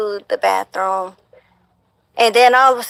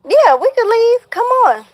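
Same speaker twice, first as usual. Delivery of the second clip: high-pitched eager; 13 semitones higher than usual. Retold or imitated. Retold own drunk agreeing quote.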